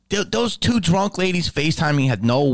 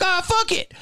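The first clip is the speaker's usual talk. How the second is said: imitating a clueless fan, much higher; dopey nostalgic fan, pitched up. Fan ignoring the smell, high dopey voice.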